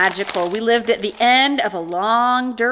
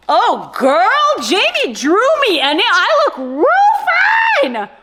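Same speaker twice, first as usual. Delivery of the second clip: loud, high joyful shriek building higher; screaming for joy; 12 semitones higher, 5 dB louder, slower than usual. Real, not acted. Vlogger sees Jamie drew her, joyful scream. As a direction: shout it out in delight, stretching the end. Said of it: "when I screamed there for joy".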